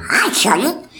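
high, squawky quacking voice; Donald Duck. Retold or imitated. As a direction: quack the greeting like a cartoon duck. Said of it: "talk like Donald Duck".

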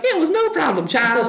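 put-on very high flamboyant old showman. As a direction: toss it off sky-high, grand.

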